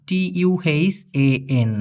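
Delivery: letters of তুহান spelled slowly and softly; slow dictation of the letters; spelling out letters. Shown as a demonstration. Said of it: "আরেকটা হচ্ছে তুহান".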